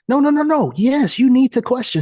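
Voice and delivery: high, insistent pushback; emphatic objection. Real, not acted. Coach countering don't talk back, high and emphatic. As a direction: jump up in pitch to overrule.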